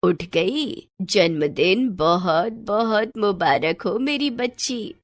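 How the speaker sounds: put-on slow, drawn-out old woman's voice; affectionate grandmother. Grandmother wishes the girl happy birthday, affectionate. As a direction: dote on the child unhurriedly.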